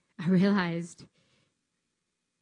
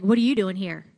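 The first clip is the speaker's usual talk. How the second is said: much louder sharp challenge; tough trainer challenge; 11 dB louder than usual. Retold or imitated. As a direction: confront her like a stern trainer. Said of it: "she was like".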